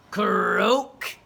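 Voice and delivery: loud croaking frog; a throaty frog croak. A narrator's reading. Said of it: "said the frog".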